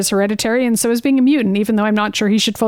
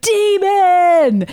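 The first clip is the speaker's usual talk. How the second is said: higher, louder hammed-up cry; mock mob shout; 8 semitones higher, 3 dB louder than usual. The mob spots the mutant outside the church, a hammed-up shout. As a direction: yell it like a scared mob. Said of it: "and they're like".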